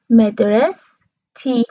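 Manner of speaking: مدرستی said slowly as madras-ti, no vowel after s; slow demo of مدرستی as madras-ti; common Arabic pronunciation. Shown as a demonstration. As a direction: close on the s, then ti: مدرستی as madras...ti. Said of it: "عموم کشورهای عربی دو سیلابی تلفظ".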